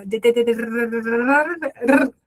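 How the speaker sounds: "de, de, de" accelerating into a rolled "r"; very fast D repeats. Shown as a demonstration. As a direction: rattle off "de" until it blurs into a trilled "r". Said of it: "de, de, muito rápido".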